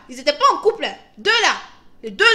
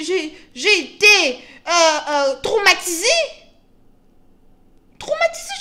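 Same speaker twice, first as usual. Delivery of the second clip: mock traumatised; 4 semitones higher, 8 dB louder than usual. Real, not acted. Shocked retelling.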